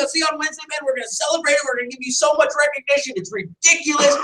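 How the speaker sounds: ordinary delivery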